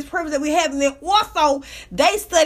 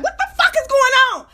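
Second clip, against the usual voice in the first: high-pitched, comic bewildered outburst; baffled exclamation; 8 semitones higher, faster than usual. Retold or imitated. Podcaster reacting to incomprehensible fast talk, mock-baffled high cry. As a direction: burst out utterly lost, pitched way up.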